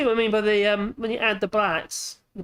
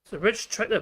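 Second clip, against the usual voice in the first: ordinary delivery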